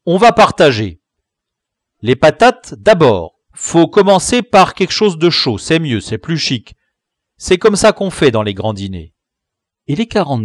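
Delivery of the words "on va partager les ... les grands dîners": bossy chief. Acted. Chief organising the meal.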